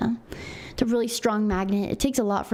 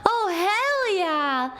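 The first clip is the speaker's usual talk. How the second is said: much higher, ecstatic thanks; overjoyed; 10 semitones higher, much slower than usual. Real, not acted. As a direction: gush with relief and joy. Overjoyed at desk working.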